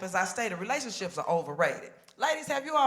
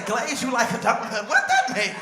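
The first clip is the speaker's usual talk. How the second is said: incredulous high-pitched question, louder; baffled; 4 semitones higher, 9 dB louder than usual. Retold or imitated. Family asking auntie what she meant, baffled high incredulity. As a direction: ask it in total disbelief.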